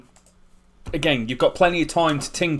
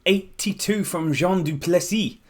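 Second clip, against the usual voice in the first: Jean du Plessis said with a clumsy French accent, higher; bad French accent; 5 semitones higher than usual. Shown as a demonstration. Game streamer naming a batsman with a weak French accent. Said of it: "my French accent isn't that good".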